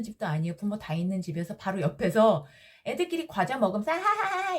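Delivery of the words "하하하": high-pitched mock giggling; imitated children's laughter. Kids chattering over snacks, high imitated laughter. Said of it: "이렇게 떠드는 거예요".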